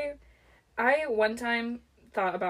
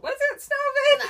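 high, slow, laughing disbelief; incredulous laughing question; 7 dB louder than usual. Retold or imitated. Friend retelling her surprise at the word, laughing question. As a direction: ask it as if you can't believe it. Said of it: "I was like".

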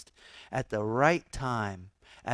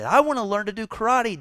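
much higher and fast, pleading; eager Daniel; 9 semitones higher, 5 dB louder, faster than usual. Retold or imitated. Preacher voicing Daniel begging to learn, high and fast. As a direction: beg like a restless teenager. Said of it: "Daniel would say".

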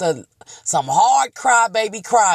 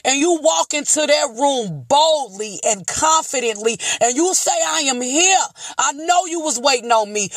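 full-force preaching; 8 semitones higher than usual. Acted. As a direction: preach it out, rising to the heavens.

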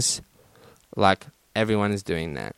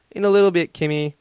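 mock annoyed, pitched higher; 7 semitones higher, faster than usual. Boyfriend brushing off his little sister, mildly annoyed. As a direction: put off a pestering child. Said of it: "he acted annoyed and said".

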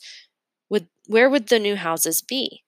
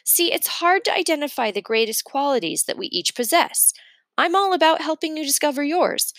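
eager teacher; 6 semitones higher than usual. A narrator's reading. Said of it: "Miss Blinny says".

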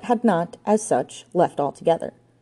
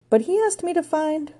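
raised voice pitch; 12 semitones higher than usual. A narrator's reading. Joan continues her account.

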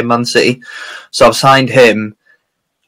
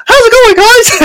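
squeaky falsetto cartoon mouse, very high and bright; Mickey Mouse voice; 4 dB louder than usual. Colleague voicing Wolfgang as a squeaky Mickey Mouse. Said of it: "Wolfgang's voice was like Mickey Mouse".

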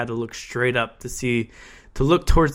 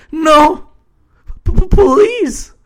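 high stammering begging; whimpering plea; 8 dB louder, much slower than usual. A narrator's reading. Stefan begs Angel, stammering high and desperate. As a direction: beg through panic. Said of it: "whimpered Stefan".

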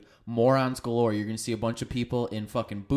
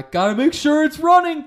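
high, loud car-owner caricature; mock hollering; 11 dB louder than usual. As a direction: yell it over a running engine. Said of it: "it's like the neighbor walks by".

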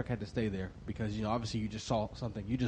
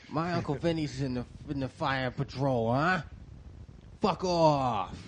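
slow, higher New York wiseguy bluster; Italian-American tough guy; 4 semitones higher, 4 dB louder, slower than usual. Pretend. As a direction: brag like a Brooklyn fireman's nephew. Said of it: "an Italian guy or an Irish guy".

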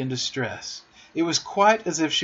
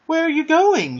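high, loud, worried question; anxious high call; misgiving; 7 dB louder than usual. A narrator's reading. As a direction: cry out with a touch of alarm. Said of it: "she cried with misgiving".

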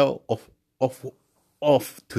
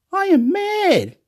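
much higher and slow, near a yelp; wild excitement; 3 dB louder, slower than usual. Real, not acted. Telling the camera he's mad, yelping high. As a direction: let it fly up, stretched out.

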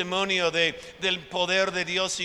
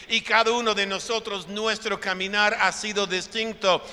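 usual speaking voice

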